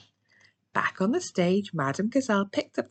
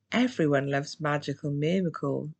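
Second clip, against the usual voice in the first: ordinary delivery, nothing marked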